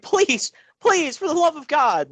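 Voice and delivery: high, pleading mock plea; mock begging. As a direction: beg like a desperate man, hammed up. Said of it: "he's like".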